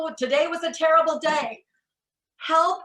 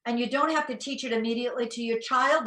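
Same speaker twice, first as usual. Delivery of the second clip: ordinary talk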